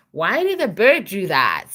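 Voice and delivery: plain reading voice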